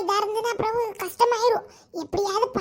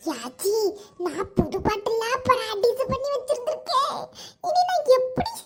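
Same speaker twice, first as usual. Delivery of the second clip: ordinary talk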